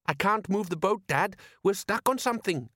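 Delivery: high-pitched complaint to Dad; young son. Acted. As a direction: report trouble in a high son's voice. Son struggling with the oars.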